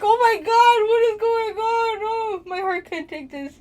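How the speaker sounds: high, loud, breathless swoon; overwhelmed by a crush. Retold or imitated. Telling her friend how she swooned, high and loud. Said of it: "I turned to you and I was like".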